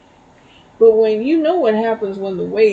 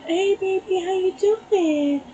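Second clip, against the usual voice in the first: high, slow, sing-song coo; drawn-out baby talk; 8 semitones higher than usual. Retold or imitated. Slowly cooing high at the groundhog that won't run. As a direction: stretch the greeting high and sweet.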